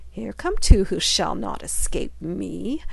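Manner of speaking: a slow, wicked witch's gloat. Acted. Witch gloating as the children approach, slow and wicked. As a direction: savour each word like a trap snapping shut. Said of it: "she laughed wickedly".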